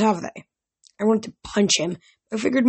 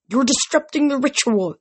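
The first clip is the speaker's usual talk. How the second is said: higher, raised protest; indignant higher protest; 6 semitones higher, 4 dB louder than usual. A narrator's reading. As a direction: object sharply, higher up. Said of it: "Nico protested".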